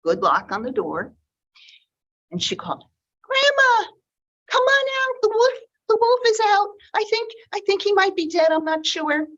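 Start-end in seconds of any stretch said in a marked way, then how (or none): 3.2-9.3 put-on much higher, breathless girl calling out; little girl voice, excited. Red calling grandmother out of the shed, high and excited. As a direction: call out fast like a thrilled small girl.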